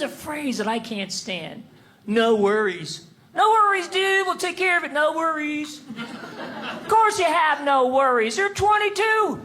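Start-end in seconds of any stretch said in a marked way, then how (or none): none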